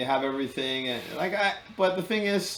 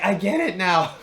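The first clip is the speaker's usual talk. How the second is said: higher-pitched mock epiphany; so excited; 5 semitones higher, 4 dB louder than usual. Man retelling his revelation to a friend at the pub, excited. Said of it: "so excited".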